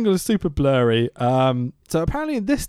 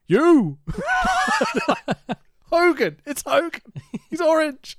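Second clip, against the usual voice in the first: retold shout; 12 semitones higher than usual. Man in orange beanie confronting the cops, mock shout. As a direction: mimic a man calling out suddenly. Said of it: "that goes".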